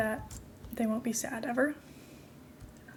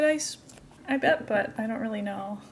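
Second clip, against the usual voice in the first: ordinary delivery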